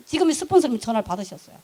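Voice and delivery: normal talking voice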